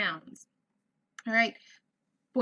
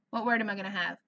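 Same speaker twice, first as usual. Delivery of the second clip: normal talking voice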